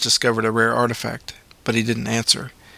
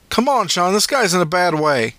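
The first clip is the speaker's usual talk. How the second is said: higher pressing plea; urgent paramedic; 7 semitones higher than usual. A narrator's reading. Paramedic urging driver Sean, pressing. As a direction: push the driver on.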